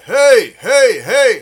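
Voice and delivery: high loud grunted hey hey hey; narrow grunts. Shown as a demonstration. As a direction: grunt each hey tight. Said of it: "So this grunt".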